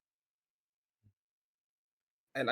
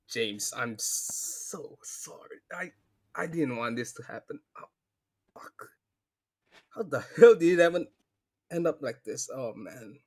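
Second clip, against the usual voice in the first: grieving; 2 semitones higher than usual. Acted. Player mourning friend's mother, grieving. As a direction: break down over the body.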